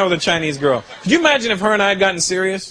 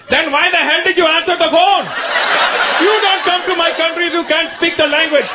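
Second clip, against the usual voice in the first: angry dad; 9 semitones higher than usual. Acted. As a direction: yell down the phone at her. Dad yelling on phone.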